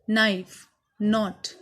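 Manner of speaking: ordinary talk, nothing marked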